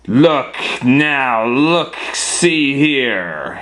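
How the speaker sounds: scolding bear's loud yell; yelling Papa Bear. Acted. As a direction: yell at an intruder, each word punched out. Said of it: "he yelled at her".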